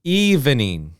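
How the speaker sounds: evening in three clear syllables, EV-E-NING. Shown as a demonstration. Teacher models the full form for the class. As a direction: spell out all three beats of evening. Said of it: "this is three sounds".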